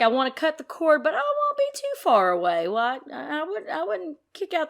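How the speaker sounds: teasing impression of the royal couple; mock royal couple. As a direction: pitch it up and play the couple wanting it both ways. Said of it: "so it's like".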